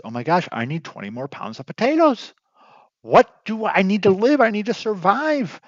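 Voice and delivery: mock desperate Ben. Ben begging for potatoes to survive, mock panic. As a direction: ham up a farmer who needs food.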